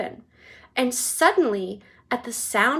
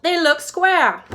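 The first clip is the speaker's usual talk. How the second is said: higher, louder, bratty insistence; Veruca insisting; 7 semitones higher, 9 dB louder, slower than usual. A narrator's reading. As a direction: Veruca arguing with Wonka, high bratty insistence. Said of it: "said Veruca Salt".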